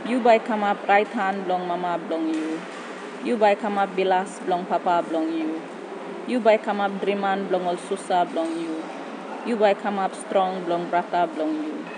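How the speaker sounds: spoken in Tok Pisin; papua new guinea pidgin. Acted. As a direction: recite in PNG pidgin. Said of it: "poem switches into Tok Pisin".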